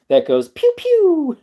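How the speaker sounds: high, slow mock gunshot noise; pew pew. Jokingly telling viewers about the revolver, high pew pew. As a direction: squeak out a toy-gun pew pew. Gun sound imitated.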